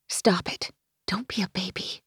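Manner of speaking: soft hushed self-rebuke; whispered scolding. Acted. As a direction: whisper it to yourself. Inner voice scolding herself.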